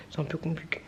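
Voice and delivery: whisper. Real, not acted. Joking aside about baby's legs.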